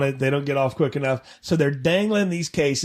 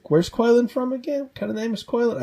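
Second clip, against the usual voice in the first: higher-pitched, puzzled questions; confused actor; 7 semitones higher than usual. Acted. Actor asks about his character's origins, acting confused. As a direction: play an actor trying to follow rewrites. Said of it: "he's probably very confused".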